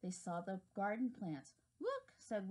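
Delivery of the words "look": high excited look. Acted. Character calls out.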